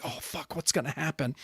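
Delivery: hushed nervous whisper; anxious whisper. Retold or imitated. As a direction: whisper it in suspense. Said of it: "I was like".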